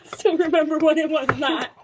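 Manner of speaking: mocking copy of her friend; mocking imitation. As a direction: parrot her line back teasingly. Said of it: "this is how she goes".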